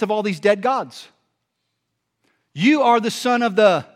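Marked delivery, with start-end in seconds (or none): 2.6-3.8 raised, higher proclaiming voice; Peter's declaration. Acted. Peter declaring to Jesus among dead gods, loud proclamation. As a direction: proclaim it boldly, high and loud.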